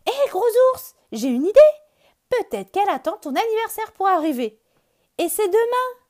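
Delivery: very high, loud excited shout; excited cry. Acted. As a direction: blurt out the great idea. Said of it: "Soudain, Lapin s'écrie".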